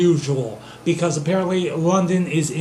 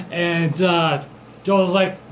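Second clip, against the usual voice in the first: usual speaking voice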